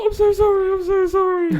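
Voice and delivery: very high, loud frantic apology; panicked apology. Retold or imitated. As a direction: squeal sorry in a panic.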